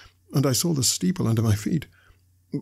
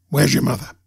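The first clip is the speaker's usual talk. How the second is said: loud, menacing order; forceful, threatening command; convict's demand; 5 dB louder than usual. A narrator's reading. Convict questioning the boy.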